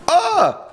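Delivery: high, loud "ah" yelp; startled scream. Real, not acted. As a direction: yelp as you nearly fall. Startled by slip in game.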